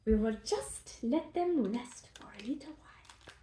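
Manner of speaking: soft hushed whisper. Real, not acted. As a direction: whisper so they can rest. Letting the rolls rest.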